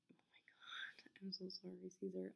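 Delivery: hushed, embarrassed whisper to the editor; whispered apology. Real, not acted. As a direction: whisper sorry under your breath.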